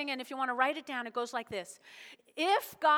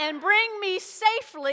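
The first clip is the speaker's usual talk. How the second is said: raised-voice rallying proclamation; loud declaration; 5 semitones higher, 6 dB louder than usual. Shown as a demonstration. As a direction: lead the congregation, projecting every word. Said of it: "Say it so loud".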